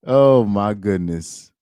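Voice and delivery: slow, amused disbelief; laughing. Real, not acted. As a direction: laugh it out slowly. Laughing at the tweets.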